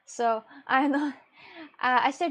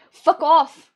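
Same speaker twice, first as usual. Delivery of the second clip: sudden full-volume shout, much louder and higher; furious shout; 5 semitones higher, 14 dB louder than usual. Retold or imitated. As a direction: blast it at whoever woke you. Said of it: "I was like".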